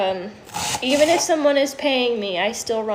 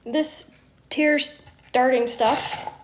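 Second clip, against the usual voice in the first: drawn out; 2 semitones higher, much slower than usual. Real, not acted. Slowed joke about onions.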